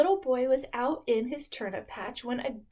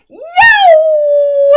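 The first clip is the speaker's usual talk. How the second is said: very high, very loud yelp; startled yelp; 17 dB louder than usual. Acted. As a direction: yelp as if bitten. Goat bitten by fly.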